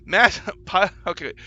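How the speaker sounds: ordinary delivery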